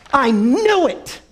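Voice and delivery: high, slow, triumphant exclaim; she's caught him out, high and slow; triumphant cry. Retold or imitated. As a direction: crow it like you were right all along. Woman triumphant over paper.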